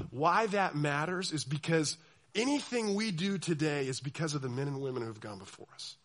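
ordinary delivery

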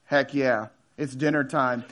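low, cool fish voice; cocky fish. Acted. Fish eyeing its dinner, cocky.

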